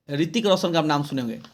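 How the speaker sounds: ordinary delivery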